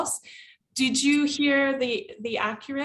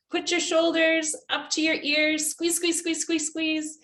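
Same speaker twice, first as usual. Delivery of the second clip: higher, rhythmic exercise instructions; coaching a stretch; 4 semitones higher than usual. Retold or imitated. Teacher leading nervous students in a stretch, higher rhythmic coaching. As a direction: drive the squeeze with quick repeated words.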